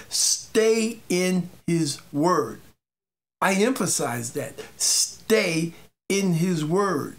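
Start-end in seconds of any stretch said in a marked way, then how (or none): 0.1-2.6 slow deliberate emphasis. Acted. Preacher stresses repeated command. 4.8-7.1 slow deliberate emphasis. Acted. Preacher repeats command slowly.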